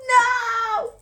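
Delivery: high, loud drawn-out cry; dramatic disbelief. Acted. Storyteller reacting to the scandal, high dramatic exclamation. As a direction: wail it in mock shock.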